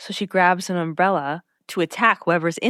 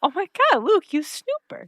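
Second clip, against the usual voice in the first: high teasing accusation; high-pitched playful chiding; high mock scold; 10 semitones higher than usual.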